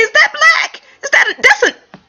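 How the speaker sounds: can't believe your eyes; rising amazement, a laugh in it; excited disbelief. Retold or imitated. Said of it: "I said".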